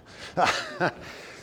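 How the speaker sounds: breathy rueful laugh; short airy chuckle. Real, not acted. Laughing at own irony.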